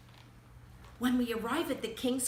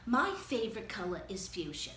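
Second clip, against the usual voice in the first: ordinary delivery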